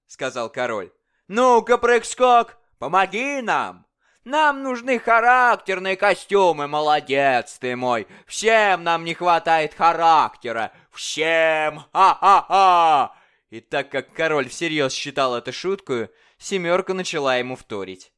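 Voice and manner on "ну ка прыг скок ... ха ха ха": loud, high, mocking tyrant king; bully the dwarf like a drunken king; boisterous royal bully demanding fun. King voiced in story.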